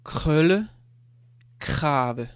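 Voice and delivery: "krølle" and "krave" said loud and clear, with a throaty back r; Danish word demo. Shown as a demonstration. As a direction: model "krølle", then "krave", for the class. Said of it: "So you have this kr".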